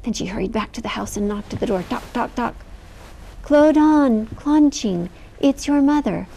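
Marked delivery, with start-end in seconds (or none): 3.4-6.3 higher, louder motherly call, slowly; mimicking mother. A narrator's reading. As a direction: call like their own mother. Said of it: "sounded exactly like the girl's mother".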